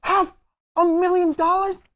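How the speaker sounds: high, loud squeal of disbelief; high, loud shocked cry, as if the floor just dropped out. Acted. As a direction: nearly shriek the sum in horror. Nephew hears the size of debt.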